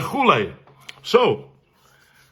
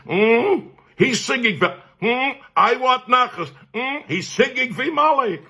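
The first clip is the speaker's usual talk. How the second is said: high-pitched, forceful mock maternal voice; insistent mother; 12 semitones higher than usual. Mother demanding her son sing tonight, high insistent voice. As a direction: imitate a mother who won't hear no. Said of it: "My mother".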